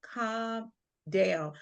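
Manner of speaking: quiet, slow calming voice; soft soothing mom. Retold or imitated. Mom quietly talking her panicked daughter down, slow and soft. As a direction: hush her gently down. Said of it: "and she was like".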